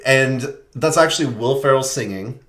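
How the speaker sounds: normal talking voice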